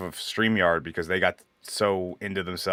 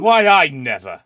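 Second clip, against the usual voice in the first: mock prim outrage; 3 semitones higher, 7 dB louder, slower than usual. Mock offended pearl clutcher.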